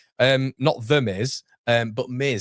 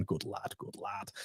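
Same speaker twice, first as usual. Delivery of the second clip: quiet aside; 2 semitones lower, 17 dB quieter, slower than usual. Real, not acted. Soft aside to viewer.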